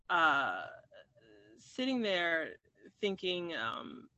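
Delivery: ordinary talk, nothing marked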